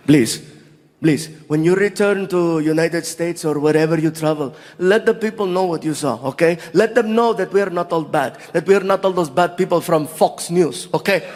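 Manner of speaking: put-on earnest plea in a Saudi accent; heartfelt Saudi-accented request; Saudi local. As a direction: plead earnestly with an Arabic accent. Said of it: "meet some of the locals".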